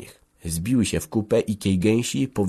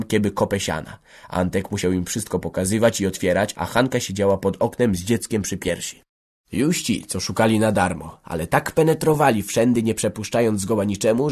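plain reading voice

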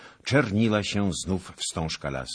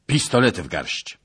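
loud, raised command; barked order; 6 semitones higher, 8 dB louder than usual. A narrator's reading. Knight to nobleman before a stand, loud commanding call. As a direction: order him to arms.

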